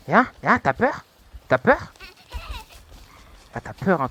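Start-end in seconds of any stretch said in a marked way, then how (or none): 0.1-1.9 high taunting calls. Real, not acted. Gamer taunting a fleeing enemy, high and loud.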